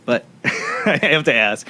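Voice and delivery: chuckling through the words; amused. Real, not acted. Host joking with guest about his own story, amused chuckle. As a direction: let a real laugh break in, words drawn out.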